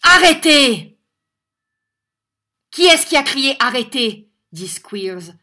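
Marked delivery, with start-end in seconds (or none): 0.0-1.0 very loud, high command; thunderous shout. A narrator's reading. Young teacher leaping up to stop a beating, thunderous shout. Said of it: "d'une voix qui fait trembler". 2.7-4.3 high, loud shout; furious demand. A narrator's reading. Schoolmaster looking round wild with anger, furious demand. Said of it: "des yeux égarés par la colère".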